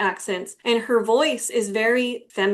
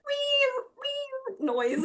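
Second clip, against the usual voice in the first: very high squeaky wee, dropping softer; imitated screech; 6 dB quieter, much slower than usual. Shown as a demonstration. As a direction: squeal it like a shrill noise. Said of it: "you hear a big ol'".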